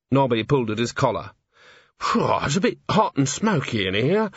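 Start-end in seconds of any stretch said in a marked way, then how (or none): none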